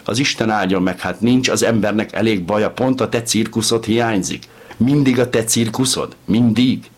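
rising despairing outburst; desperate pleading. A narrator's reading. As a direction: beg him, close to tears. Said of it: "könyörgött".

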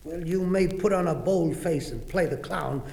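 ordinary delivery, nothing marked

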